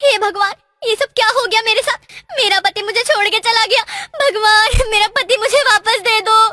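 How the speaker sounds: loud weeping lament, voice breaking; wailing widow. Acted. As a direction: sob out the prayer in grief.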